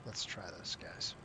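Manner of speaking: breathy whisper to himself. Real, not acted. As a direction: whisper it under your breath. Muttering while trying a maneuver.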